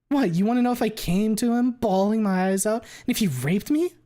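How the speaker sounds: high, loud furious mockery; pissed off. Fang snaps back at the accusation, high and loud in rage. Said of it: "She's really pissed off now".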